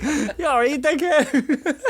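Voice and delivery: high, loud laughing call; laughing concern. Retold or imitated. Mates check on winded Moo, helpless high laughter. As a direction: barely get it out for laughing.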